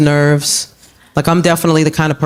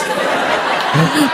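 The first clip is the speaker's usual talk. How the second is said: high laugh; 8 semitones higher, 4 dB quieter than usual. Real, not acted.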